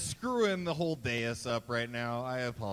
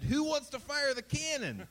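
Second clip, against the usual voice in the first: higher, cheery guide call; tour guide call; 9 semitones higher than usual. Retold or imitated. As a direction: call out to the crowd. Retold guide calling volunteers.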